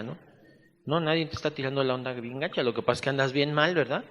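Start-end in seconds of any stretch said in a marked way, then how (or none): none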